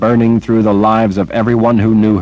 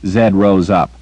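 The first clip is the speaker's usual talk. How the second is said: usual speaking voice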